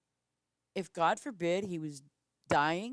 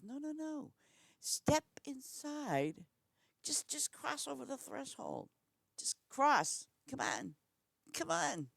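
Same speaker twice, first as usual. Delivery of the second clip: sugary taunt, pitched up and drawn out; sweetly inviting; 9 semitones higher, slower than usual. Retold or imitated. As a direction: lure her in with mock sweetness. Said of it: "very nicely".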